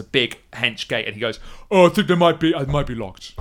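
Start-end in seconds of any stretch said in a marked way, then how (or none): 1.7-3.3 dim brute voice. Acted. Zebish after charging gate.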